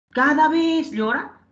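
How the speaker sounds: CADA VEZ stretched high, rising questioning end; incredulous question. Shown as a demonstration. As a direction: lean slowly on "cada vez", doubting it. Teacher mockingly questions wrong answer. (énfasis incrédulo y alargado en "cada vez")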